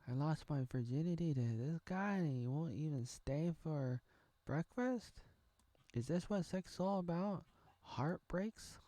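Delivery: high, plaintive mock heartbreak; jilted girl. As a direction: play a girl left after one night. Voicing the jilted hobbit girl.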